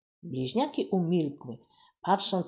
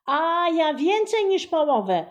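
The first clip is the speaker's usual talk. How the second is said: high, loud one-upping shout; boy bragging louder; competitive boy; 11 semitones higher, 8 dB louder than usual. A narrator's reading. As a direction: top his sister's boast. Twins outdoing each other.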